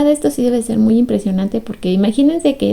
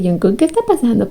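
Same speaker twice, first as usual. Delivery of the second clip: ordinary talk, nothing marked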